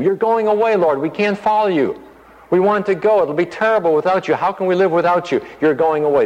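high, pleading voice of the disciples; a mock lament, pitched high. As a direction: plead like anxious followers who are left behind.

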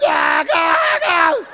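high, loud gasping cries; mock horrified cries. Father seeing baby's cone head, mock horrified cries. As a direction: cry out in mock horror.

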